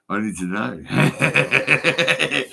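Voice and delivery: slow words dissolving into laughter; breaking up laughing. Real, not acted. Speaker to listener, cracking up while pleading.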